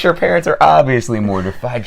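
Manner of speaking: ordinary talk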